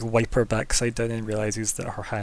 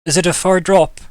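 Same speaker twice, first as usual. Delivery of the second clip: higher, louder fretful question; fussing; 6 semitones higher, 11 dB louder than usual. Acted. Sarah hesitates before climbing into the sewer, fussing. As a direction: fuss over it, pitched up and loud. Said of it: "what she's fussing about".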